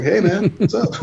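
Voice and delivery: a bright mock hello from the scary-looking band; friendly boss. Retelling the surprise, high cheery mock greeting. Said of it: "And the fucking boss, like".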